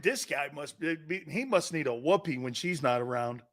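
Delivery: normal talking voice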